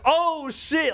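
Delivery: very high, drawn-out astonished cry; retold excited yell. Host retells watching the giant punch, high excited yell. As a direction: relive yelling at the screen. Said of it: "Like, I was yelling".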